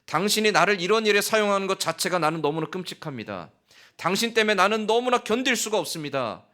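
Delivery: high, loud despairing protest; anguished outcry. Acted. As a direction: cry out in bitter anguish. Jonah's despairing tirade at God.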